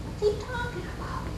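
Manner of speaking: lightly voiced puzzlement, high and soft; a soft, high aside to himself; puzzled gentleman. Gentleman up in the stands watching the boy, lightly puzzled.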